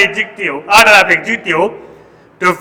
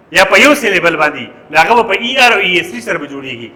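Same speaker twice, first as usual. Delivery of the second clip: usual speaking voice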